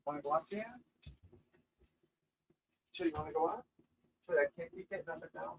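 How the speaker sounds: hushed, gentle coaxing of the dog; soft coaxing. Real, not acted. Owner softly coaxing his dog to go look outside, hushed. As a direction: coax the dog softly, barely voiced.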